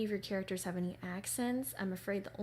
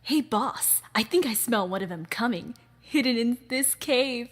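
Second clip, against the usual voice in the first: loud, higher lackey voice calling to the boss; eager henchman; 5 semitones higher, 10 dB louder than usual. Acted. Henchman telling the boss he smells one, loud. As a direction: sniff out the intruder and report it.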